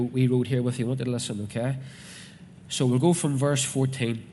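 ordinary talk, nothing marked

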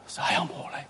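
whispered scripture ending; solemn whisper. Acted. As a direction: let it fade into a reverent whisper. Hushed scripture quote.

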